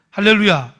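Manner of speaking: loud falling shout of 할렐루야; fervent preacher's 할렐루야; hallelujah call. Real, not acted. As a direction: call it to the church. Preacher calls out.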